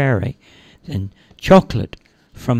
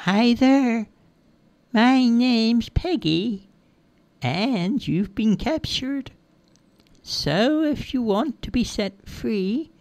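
high, slow, drawn-out character voice; teasing captor in a high, slow lilt; Peggy, sing-song and bossy; 10 semitones higher, 3 dB quieter, slower than usual. Acted. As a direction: pitch it high and draw out each word. Child narrator's figure greets captured fairy.

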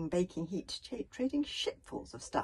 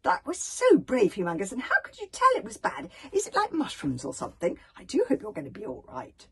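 loud, high gushing opening that settles; excited chattering; 5 semitones higher, 9 dB louder than usual. A narrator's reading. Hiccup fusses over the poorly bodyguard, chattering. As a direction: chatter on eagerly, one question after another. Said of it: "with Hiccup chattering all the time".

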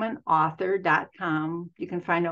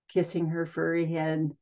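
usual speaking voice

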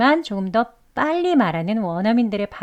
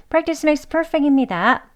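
cheerful catchphrase; 6 semitones higher, much slower than usual. Real, not acted. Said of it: "sign-off catchphrase".

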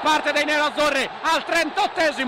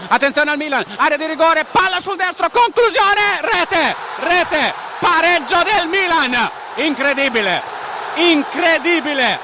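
breathless italian goal commentary; 5 dB louder than usual. Acted. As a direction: scream the equaliser. Equaliser goal call. (urlo concitato del gol)

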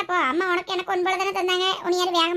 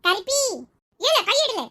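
high, loud sibling yell; angry shout; 6 semitones higher, 5 dB louder, slower than usual. Acted. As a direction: shout after her as she gets away. Brother yelling at fleeing sister. (உரத்த குரலில் கோபக் கத்தல்)

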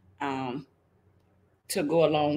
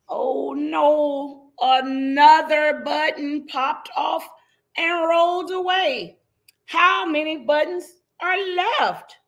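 higher and louder dismay; mild dramatic dismay; 7 semitones higher, 5 dB louder than usual. Acted. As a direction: mourn the lost button playfully. Dramatic storybook moment.

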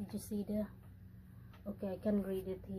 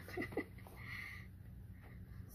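soft, voiceless chuckle; breathy laugh; 13 dB quieter than usual. Real, not acted. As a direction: laugh quietly with mostly breath. Laughs while adjusting shirt.

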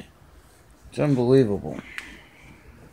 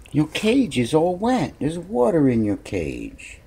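usual speaking voice

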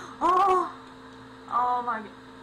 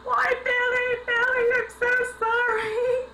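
mock sorry wail; 11 semitones higher, 5 dB louder than usual. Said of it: "And I was like".

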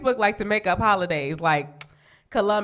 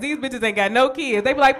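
usual speaking voice

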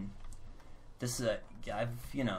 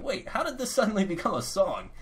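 surprised; 10 semitones higher, 8 dB louder than usual. Retold or imitated. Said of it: "You're like".

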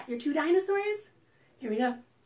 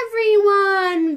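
loud, excited yell; 5 semitones higher, 10 dB louder than usual. Acted. Vinny the Velociraptor inviting everyone, loud excited yell. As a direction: shout it out with no inside voice. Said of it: "Vinny yells".

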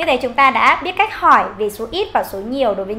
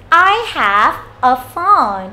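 slow, loud and clear teacher demo; slow model answer; 3 semitones higher, 5 dB louder, much slower than usual. Shown as a demonstration. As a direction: model it slowly for kids. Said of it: "nói luôn là".

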